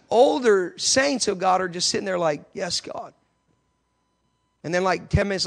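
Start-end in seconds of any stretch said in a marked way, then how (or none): 2.5-3.1 soft, understated prayer response; quiet assent. Retold or imitated. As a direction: imitate elders murmuring agreement. Elders' hushed prayer responses, acted out.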